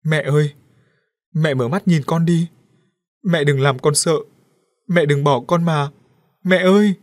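put-on a boy wailing at his mother's body; heartbroken wailing. A narrator's reading. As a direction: cry out, building to a howl. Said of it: "kêu gào xé ruột".

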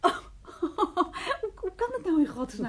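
high, laughing astonishment; thrilled disbelief; amazed. Real, not acted. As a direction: gasp the question in wonder. Amazed by mirror.

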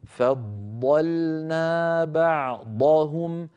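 فضلنا بعضهم very slow with emphatic ض in both words; tajweed recitation. Shown as a demonstration. Teacher reciting the verse for students in slow tajweed. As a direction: recite فضلنا بعضهم slowly, each ض full and heavy. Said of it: "بعدها لام مرققة".

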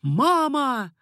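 high, drawn call. A narrator's reading. Son answers his mother at the window, calling out.

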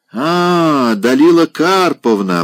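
loud, drawn-out high greeting; drawn-out exclamation. A narrator's reading. Old general hails Polina across the room, drawn out and loud. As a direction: stretch the name out, calling across the room. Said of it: "протяжно воскликнул Нил Андреевич".

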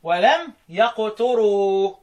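"يقتروا" slowly as yaqturu, u on the ta; Kufan reading. Shown as a demonstration. Teacher reciting Asim Hamza and Kisa'i's "يقتروا" as yaqturu. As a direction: round the ta in "يقتروا", ya-qtu-ru, slow. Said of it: "وضم التاء".

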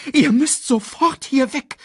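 high, loud, urgent order; commanding. A narrator's reading. Sasu orders the young lions away, urgently commanding. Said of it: "befahl Sasu".

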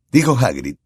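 ordinary delivery, nothing marked

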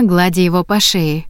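usual speaking voice

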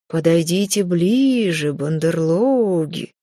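slow hollow voice from the grave; sepulchral voice. Acted. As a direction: summon them like a ghost, dragging it out. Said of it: "за могильным голосом".

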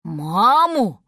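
mild astonished query. A narrator's reading. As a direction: walrus taken aback. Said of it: "дядюшка Морж был поражён".

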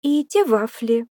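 put-on eager small-girl voice; little girl. A narrator's reading. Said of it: "добавила Димфна".

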